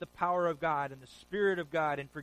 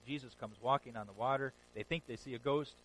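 ordinary talk, nothing marked

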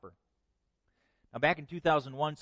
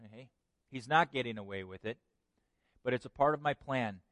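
ordinary talk, nothing marked

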